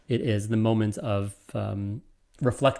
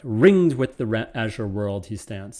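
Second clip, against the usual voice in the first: "Ringed" punched louder and slower; stress on Ringed; 3 dB louder than usual. Shown as a demonstration. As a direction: open the line hard on "Ringed". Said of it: "done in a different way".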